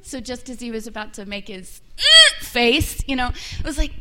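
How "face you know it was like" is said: ordinary delivery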